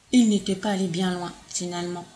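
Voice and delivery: ordinary delivery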